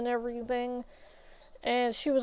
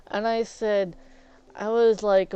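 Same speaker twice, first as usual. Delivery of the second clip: ordinary talk, nothing marked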